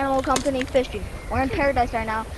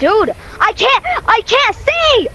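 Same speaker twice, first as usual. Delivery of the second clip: high, slow, loud complaint; loud exclaim; 9 semitones higher, 8 dB louder, slower than usual. Real, not acted. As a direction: cry out that you can't see. Can't see in game.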